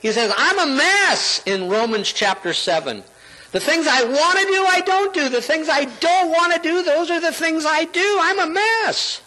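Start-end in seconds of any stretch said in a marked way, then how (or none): 0.4-1.4 high, drawn-out lament as Paul; acted-out cry of self-reproach; exasperated confession. Acting out Paul's despairing confession. 3.5-9.2 acted-out torment at one's own failings; Paul. Acting out Paul's frustrated confession.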